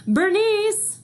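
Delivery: high, loud yell across a playground; calling out loud. Acted. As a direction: call her name from afar, sharply. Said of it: "my mama's voice cut across the playground".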